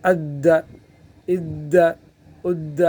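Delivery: اد held hard with doubled د; اد سختی کے ساتھ; doubled dal drill. Shown as a demonstration. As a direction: grip the د in اد firmly, then release it. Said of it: "سختی کے ساتھ پکڑیں گے".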